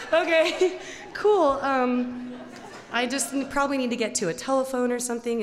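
high, loud, laughing, fast at the end; nervous laughing reply. Retold or imitated. To the strange truckers, a high nervous laugh. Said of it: "And I'm like".

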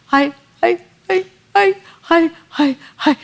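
put-on high, gasping child's stutter; hyperventilating toddler. Little girl in her crib gasping to mommy, high child voice. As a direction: imitate a crying toddler's gasps. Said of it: "She's hyperventilating".

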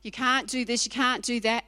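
ordinary talk

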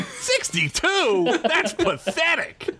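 very high, drawn-out incredulous scorn; hammed-up high-pitched outrage; mock disgust. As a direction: squeal it slowly in exaggerated disbelief. Acted scorn at stats screen.

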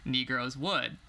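measured book-reading voice; reading aloud. Acted. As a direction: read the passage out evenly. Reads a book passage.

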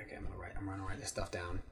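very low, quick, soft mutter; muttering scribe. Acted. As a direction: mumble to yourself while jotting notes. Acted scribe talking while writing.

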